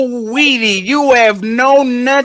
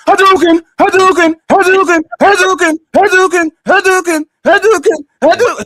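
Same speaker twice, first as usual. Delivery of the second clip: high, loud video-game attack cry, repeated identically; mechanical, high-pitched battle shout; spammed game character; 8 semitones higher, 5 dB louder than usual. Acted. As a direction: bark it like a fighter spamming one move. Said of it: "the other character is like this".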